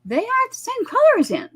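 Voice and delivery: a retold quote acted out high and deliberate; imitating how they said it. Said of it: "This is how they said it".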